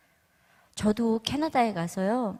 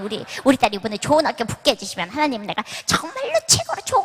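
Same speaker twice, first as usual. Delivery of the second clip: frantic praying mother; 5 dB louder than usual. Retold or imitated. A rival mother shouts her prayer louder, mock frantic. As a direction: ham up a desperate prayer that outdoes the neighbour. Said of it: "이쪽에 있는 아줌마가".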